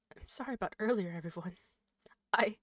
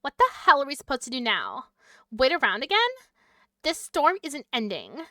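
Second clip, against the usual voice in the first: angry character demand; 4 semitones higher, 8 dB louder than usual. Acted. Character scolds player.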